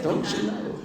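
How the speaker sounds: high mock-outraged squeal with laughter; mock indignation. Speaker laughs at his old self, high mock indignation. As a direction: replay the offended big shot, laughing.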